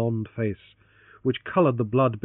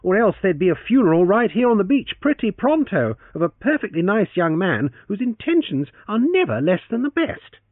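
teasing; 9 semitones higher, 6 dB louder than usual. Acted. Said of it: "he teased on".

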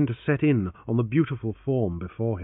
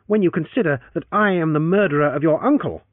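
wicked; 5 semitones higher, 5 dB louder than usual. Acted. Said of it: "he assured her wickedly".

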